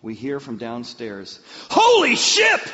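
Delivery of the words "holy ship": very high, loud mock shout; shouted exclamation. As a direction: holler it like a startled son from downstairs. Retold son's shout from downstairs.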